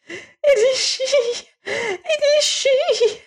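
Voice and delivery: very high, slow crying exclamation; a tiny creature struck to the soul; anguished little alien. Acted. Alien gasps recognizing wife's name.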